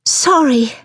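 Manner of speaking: high, startled sorry; gasped apology. A narrator's reading. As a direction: blurt it as if caught out. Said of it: "Poppy gasped".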